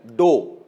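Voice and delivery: डो said like the English word dough, one long o. Shown as a demonstration. Teacher showing students the partial sign is read डो, like dough. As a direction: pronounce डो exactly as dough, the kneaded flour. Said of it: "जैसे आटा नहीं pronounce करते हम लोग".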